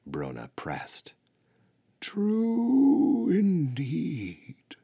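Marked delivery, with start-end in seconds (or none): 2.0-4.8 put-on very slow, high, drawn-out old voice; ancient tortoise, gravely. A narrator's reading. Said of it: "she began gravely".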